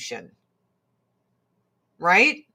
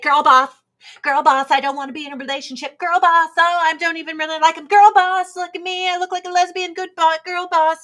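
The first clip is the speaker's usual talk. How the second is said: high, rapid-fire parody with punched catchphrase; mock girl boss; 7 semitones higher, 5 dB louder, faster than usual. Mocking Lois Lane refusing a relationship, brash girl-boss parody. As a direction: hammer the catchphrase loud, rattle off the rest high. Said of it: "She was like".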